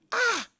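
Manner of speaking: very high short "ah"; mock exasperated cry, shooting up in pitch; retold cry of frustration. Preacher reliving his failed prayers for healing, exasperated cry. Retells frustration with unhealed pneumonia.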